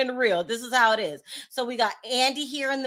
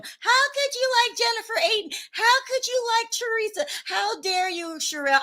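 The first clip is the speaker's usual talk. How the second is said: high-pitched mock outrage; outraged fans; 12 semitones higher than usual. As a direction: imitate scandalized viewers scolding you. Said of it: "You guys all cloud my D M".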